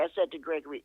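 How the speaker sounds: normal talking voice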